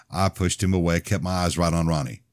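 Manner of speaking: usual speaking voice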